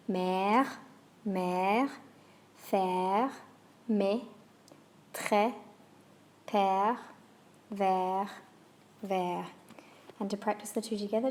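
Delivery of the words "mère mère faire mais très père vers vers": «Mère», «faire», «très» each with a long, open French è; French è words. Shown as a demonstration. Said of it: "Let's hear those words one more time".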